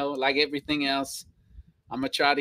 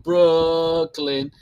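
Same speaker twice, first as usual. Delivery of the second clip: Brooklyn with a long, loud first syllable; stretched-out Brooklyn; 5 dB louder than usual. Shown as a demonstration. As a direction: hold the BROOK, stadium style. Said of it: "you do it".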